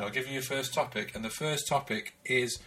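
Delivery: ordinary talk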